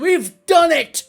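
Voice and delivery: high, loud, drawn-out cheer; triumphant shout. Acted. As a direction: shout it out in victory. Adviser announces plant success.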